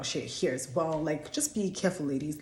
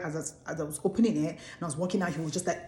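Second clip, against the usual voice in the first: normal talking voice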